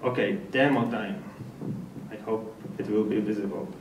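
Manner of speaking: ordinary talk, nothing marked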